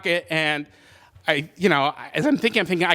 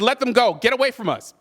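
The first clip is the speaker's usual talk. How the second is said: retold order, pitched up; higher, urgent mock command; nervous command; 6 semitones higher than usual. Shaking gun holder ordering muggers off, retold nervous command.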